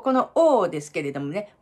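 normal talking voice

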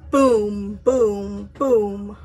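low, slow booms of a giant's steps; booming giant footsteps. Acted. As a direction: stomp out each boom like a giant walking.

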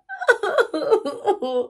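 high mock sobs; hammed-up boo-hoo wail; pretend bawling. Sarah upset with the magician, mock bawling. Sarah cries after complaining.

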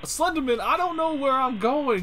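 high, flustered shouting; exasperated yell. Real, not acted. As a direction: yell at the monster blocking you. Lost, monster blocking the way.

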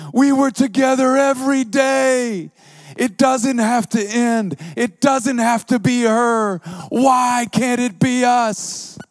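high, loud, wailing plea; imitating a sobbing yell. Young woman pleading with Alex, high sobbing yell. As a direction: wail it like a heartbroken young woman. Preacher voices heartbroken woman.

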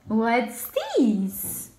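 usual speaking voice

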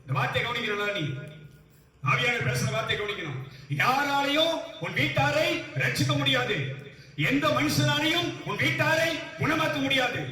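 high, insistent preacher's declaration; emphatic preaching. Real, not acted. Preacher telling the congregation no human can save their household, emphatic preaching. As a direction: preach it high, drawing out the key words.

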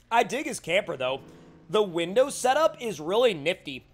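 ordinary delivery

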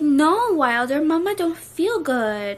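put-on playful whiny baby voice to her son; mock whiny baby talk. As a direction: pout it out like a sulky toddler. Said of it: "Like, talk like that".

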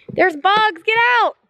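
high-pitched urgent alarm; real bug panic. Real, not acted. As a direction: urge them out, startled by bugs. Fleeing bugs in the car.

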